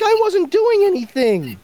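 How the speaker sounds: high, whining protest; whiny criminal voice. Retold or imitated. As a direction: squeal it out like a caught crook. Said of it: "He's like".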